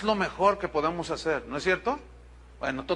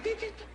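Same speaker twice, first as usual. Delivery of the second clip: hopping bunny sound; 8 dB quieter than usual. Retold or imitated. Rabbit hopping after "brincó el conejito.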